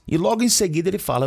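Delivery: normal talking voice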